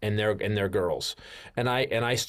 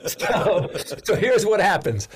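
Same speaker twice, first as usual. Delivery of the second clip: talking through real laughter, pitched up; embarrassed laughter; 6 semitones higher, 3 dB louder than usual. Real, not acted. Storyteller confessing his embarrassing fan letter, laughing. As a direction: laugh helplessly at your own shame.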